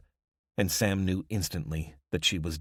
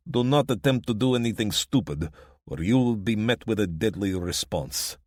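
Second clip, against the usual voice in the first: put-on commanding demand in a heavy Eastern European accent; loud, formal Eastern European ultimatum; thick Eastern European accent; 4 semitones higher, 7 dB louder than usual. A narrator's reading. Boss orders the family out, Eastern European accent. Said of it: "in a thick Eastern European accent".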